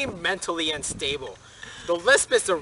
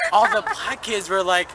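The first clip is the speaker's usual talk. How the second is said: usual speaking voice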